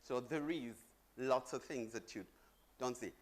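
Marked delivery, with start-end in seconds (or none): none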